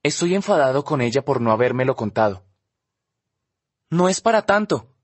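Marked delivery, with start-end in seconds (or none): 3.9-4.9 raised, brushing it off; defensive. Acted. Olivia shrugging off Justin, defensive. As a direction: wave it away defensively, a touch high. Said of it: "contesta a la defensiva".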